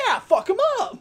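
high, loud hyped shout; mock pumped up. Husband suddenly hyped after the pep talk, shouting. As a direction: fire up like a hype man.

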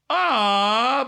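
loud, high drawn-out Boston "ah"; Boston accent. Retold or imitated. Woman reacting to a stranger's joke, Boston accent. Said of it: "in a deep Boston accent".

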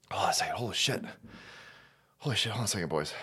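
hushed, low, stunned whisper. Real, not acted. Stunned by what's on screen, hushed whisper. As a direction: breathe the words in disbelief.

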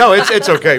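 real laugh, loud and high; high, loud, amused reassurance. Real, not acted. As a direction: burst out laughing, then reassure through it. Laughs while reassuring the host.

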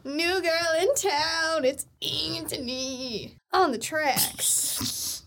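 drawn out, slow announcer tag; slow producer tag. Pretend. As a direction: stretch it like a beat tag. Mock producer tag intro.

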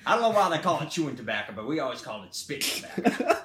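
hammed-up amateur Southern accent; bad Southern accent. Country man explaining spitting tobacco, bad Southern accent. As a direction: bluster through a shaky attempt at Southern speech in a Southern accent. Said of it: "I have a really bad Southern accent".

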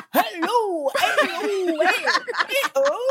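high mock British hellos; terrible British accent. Guest mimics shockers she has heard, bad British accent. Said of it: "I have heard some shockers".

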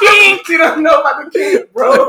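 shouting. Acted. Shouting joke punchline.

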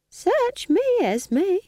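put-on high, slow, sly old woman; sly smiling innocence. A narrator's reading. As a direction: feign innocence through a smile, pitched high, slow. Nanny shrugs it off.

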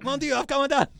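put-on very high, loud French-accented wail; hammed-up French hero crying out in despair; appalling French accent. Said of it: "my French accent is appalling".

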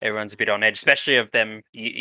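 ordinary talk

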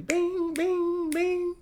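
high, sung-out bings like a phone alert; ping each one like a notification chime. Retold or imitated. Imitating his phone blowing up with messages, high pings.

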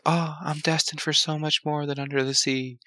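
airy, high mermaid voice; dreamy princess voice. Retold or imitated. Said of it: "she's all like".

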